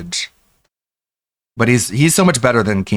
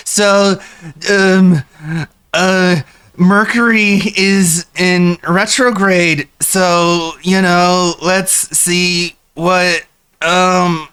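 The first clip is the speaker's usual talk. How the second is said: mocking Phil; 5 semitones higher, much slower than usual. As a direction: play Phil stalling for an answer. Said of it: "He's like".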